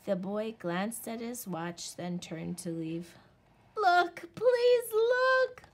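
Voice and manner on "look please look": high, loud desperate begging. A narrator's reading. Little Wolf to his parents, desperate loud begging. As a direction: beg before he's gone. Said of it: "Little Wolf begged".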